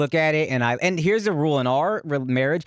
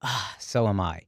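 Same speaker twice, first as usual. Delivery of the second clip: lower, softer, slow comeback; mock weary retort; 10 semitones lower, 6 dB quieter than usual. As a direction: drag it out, tired and unimpressed. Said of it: "she goes".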